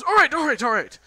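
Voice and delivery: high, loud, hurried giving in; cornered dwarf's yelped agreement; flustered surrender. Acted. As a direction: give in under threat, panicky.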